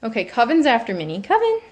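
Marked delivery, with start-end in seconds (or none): 1.2-1.6 high sing-song name; calling the cat. Real, not acted. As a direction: call her in a high pet voice.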